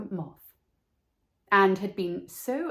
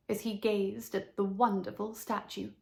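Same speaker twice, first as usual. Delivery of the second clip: ordinary delivery, nothing marked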